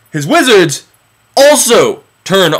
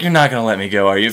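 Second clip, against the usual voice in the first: low, softer knowing question; resigned aside; 10 semitones lower, 6 dB quieter, faster than usual. Acted. Prophet to ruler who keeps lying, quiet resignation. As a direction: drop low and soft, expecting the refusal. Moses wearily expects another refusal.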